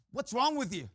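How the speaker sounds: ordinary talk, nothing marked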